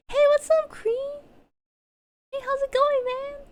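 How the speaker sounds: mock high, friendly greeting well above her own pitch; imitating Nano Dan in person. To the streamer meeting her in person, mock high casual greeting. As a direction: play him as a high, chirpy guy saying hello. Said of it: "he's like".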